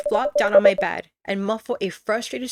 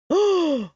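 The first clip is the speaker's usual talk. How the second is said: startled gasp; 8 semitones higher, 4 dB louder than usual. Real, not acted. Thinks she sees him in window.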